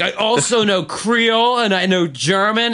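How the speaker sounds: high, mock drunk bragging; Kimmy. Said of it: "she's like".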